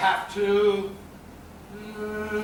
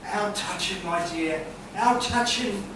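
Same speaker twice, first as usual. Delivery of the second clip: mock touched; 5 dB quieter than usual. Sarcastic reply to turkey story.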